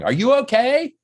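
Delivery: high, loud concerned call; imitated yelling. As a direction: call out as if through a car window. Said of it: "Yelling through the window".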